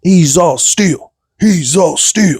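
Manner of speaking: high, drawn-out chant; hyped catchphrase call. Pretend. Fan hails the winning champ, drawn-out catchphrase call. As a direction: stretch it out slow and high like a hype man.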